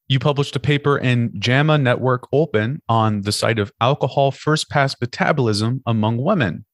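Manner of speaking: normal talking voice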